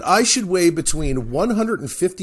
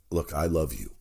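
ordinary talk, nothing marked

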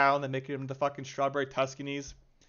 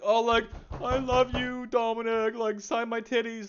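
mocking fangirl voice; 6 semitones higher than usual. Streamer parodying fans fawning over the dev at the AMA, high mock gush. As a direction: ham up a starstruck fan swooning over Dominic. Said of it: "be like".

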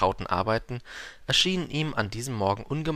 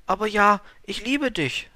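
high flower voice confessing love; Liebesgeständnis der Blume; 11 semitones higher, 4 dB louder than usual. A narrator's reading. (hohe Blumenstimme, gesteht Liebe)

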